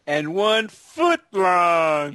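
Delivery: drawn-out slow sly line; slow sly emphasis. Retold or imitated. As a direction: stretch it out slowly, sly. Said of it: "he goes".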